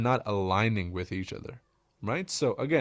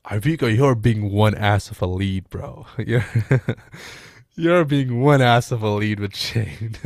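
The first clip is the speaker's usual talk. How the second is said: laughing; 5 dB louder, slower than usual. Real, not acted.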